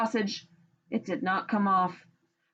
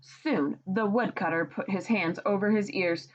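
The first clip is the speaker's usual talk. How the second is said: ordinary delivery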